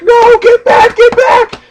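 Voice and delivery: high, loud hammed-up panic; mock panicked shout. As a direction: yell it like you're losing control. Retold panic during power transfer.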